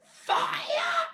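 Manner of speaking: shrieking high shout; yelling. A narrator's reading. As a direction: scream the alarm. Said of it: "the old woman yelled".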